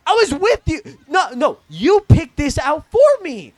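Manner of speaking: high, loud exasperated objection; indignant protest. Retold or imitated. Protesting to the friend who picked the hoodie, indignant protest.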